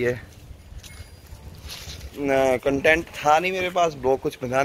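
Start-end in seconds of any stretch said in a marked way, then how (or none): none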